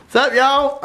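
bright high hello to viewers; upbeat greeting. Real, not acted.

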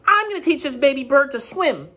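high and loud, boasting; proud Duck. Acted.

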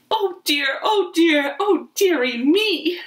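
high fretting oh dear; a touch of high mock worry; worried. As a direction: fuss like a worried horse. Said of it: "said a worried Mister Horse".

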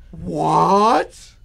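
imitating anime characters in disbelief; shocked what. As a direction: blurt it out dead-eyed and floored. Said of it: "dead-eyed shocked".

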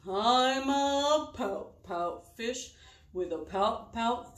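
slow, glum fish refrain, stretched out; sulky pout fish. Acted. Fish replying to the clam, slow and glum. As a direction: drag it out like a sulking fish.

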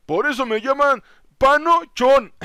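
loud, high, slow announcement; mock proud proclamation. Gamer boasts of his nickname after blunder, loud proclamation. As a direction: proclaim the nickname with drawn-out pomp.

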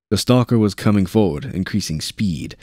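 ordinary delivery, nothing marked

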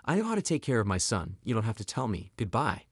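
usual speaking voice

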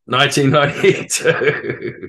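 high, loud mock-wistful; hammed-up fond memory; sarcastic nostalgia.

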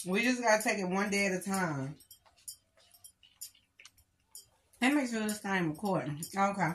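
chewing while talking; eating between words; mouth full of food. Real, not acted. Seen 1: woman repeatedly spoons food from cup into mouth while speaking.

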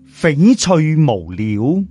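ordinary delivery, nothing marked

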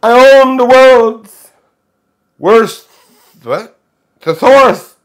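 loud, high proclamation; Barack Obama. Acted. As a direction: declare it like a speechmaking parody. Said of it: "Read the joke like Barack Obama".

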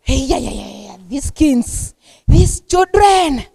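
put-on a mom fed up with her kids; exasperated mom. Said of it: "you're like".

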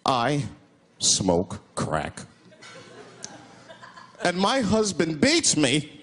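halting, slow mock confession; understated imitation of a crying woman; mock tearful confession. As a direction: confess it slowly, word by word. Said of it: "She's crying".

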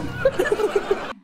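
high bursting laugh. Real, not acted. Laughing at the doctor's scolding line. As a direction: cracks up high and hard.

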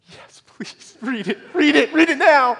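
strained start bursting into a high yell; hammed-up urgent plea; desperate begging. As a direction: beg frantically, building to a shout. Acted desperate plea to be read to.

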